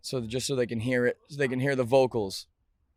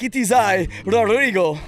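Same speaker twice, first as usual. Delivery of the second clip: hammed-up Spanish accent; mock Spanish accent, loud and proud; 6 semitones higher, 7 dB louder than usual. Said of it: "Can you do a Spanish accent".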